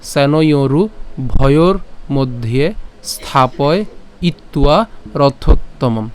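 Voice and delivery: slow, phrase by phrase; broken-up recitation. Shown as a demonstration. Teacher breaks the line into parts, slow chant. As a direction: chant the last line slowly in pieces. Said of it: "একটু ভেঙে বলি".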